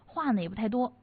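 ordinary talk

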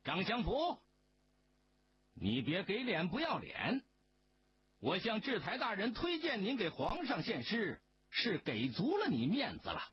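high, heated scolding; angry outburst. A narrator's reading. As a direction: let the rage finally break. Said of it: "李启龙终于发火了".

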